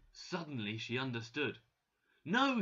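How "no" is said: high, loud cry of denial; shouting. A narrator's reading. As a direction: shout it out in protest. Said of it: "she shouted".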